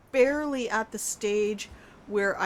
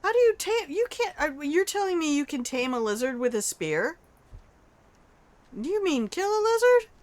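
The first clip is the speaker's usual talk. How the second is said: incredulous; 8 semitones higher than usual. Real, not acted. Incredulous reading of chat.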